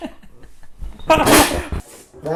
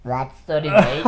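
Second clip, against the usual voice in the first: helium; 4 semitones lower, 4 dB louder than usual. Real, not acted. Helium game, inhaled gas.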